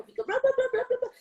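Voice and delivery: high, rapid jangling blah-blah; imitação de sininho de vaca. Retold or imitated. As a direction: rattle out blahs like a cowbell. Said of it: "parecendo sininho de vaca". (imitando sininho de vaca)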